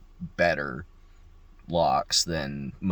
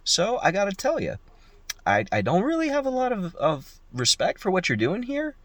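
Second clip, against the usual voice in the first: indignant telling-off; 10 semitones higher than usual. Retold or imitated. Locksmith lecturing the husband.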